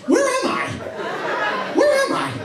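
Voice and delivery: high, loud cry of confusion; high-pitched bewildered outburst. Acted. Acts out someone lost, bewildered.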